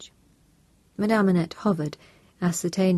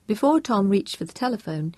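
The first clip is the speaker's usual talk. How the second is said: ordinary delivery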